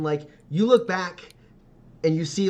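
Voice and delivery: ordinary talk, nothing marked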